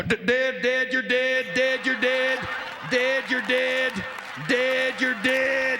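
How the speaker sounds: words blared like a truck horn; imitated truck horn blasts; semi honking. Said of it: "a semi honking at you, to me, sounds like".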